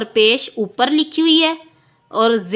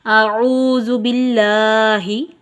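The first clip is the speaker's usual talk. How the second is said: very slow, drawn-out Quranic recitation; measured tajweed recitation; much slower than usual. Shown as a demonstration. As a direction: recite slowly for the class to repeat. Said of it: "मैं पढ़ती हूँ, आप मेरे पीछे दोहराइएगा".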